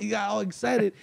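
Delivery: ordinary talk, nothing marked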